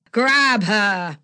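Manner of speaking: sharp commanding yell; yelled order. Acted. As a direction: bark the order at your thug. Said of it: "Slick yelled".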